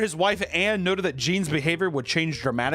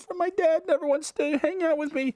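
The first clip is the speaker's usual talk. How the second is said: put-on much higher, childlike whimper; sad little boy. Little Gene complains to the servant. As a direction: sulk like a lonely boy. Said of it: "He's like".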